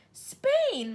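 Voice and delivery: put-on a girl aghast at the news; shocked cry. A narrator's reading. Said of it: "Matilda cried".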